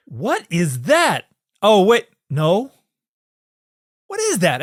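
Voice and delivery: high, drawn-out baffled question; Bill Murray. Retold or imitated. Retelling a comedy skit to a co-host, high baffled impression. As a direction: act out mounting puzzlement at something far off. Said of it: "Bill Murray walks out into the middle".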